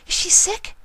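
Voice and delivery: high, timid pony voice; Fluttershy asking friends, high and worried. A narrator's reading. High worried pony voice.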